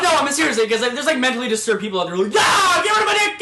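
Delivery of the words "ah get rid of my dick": high, loud, hammed-up shout; mock frantic yell. An unstable patient demands surgery with a high mock yell. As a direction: yell it like a panicked madman. Imitating disturbed person yelling.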